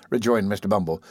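usual speaking voice

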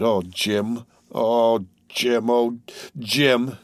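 usual speaking voice

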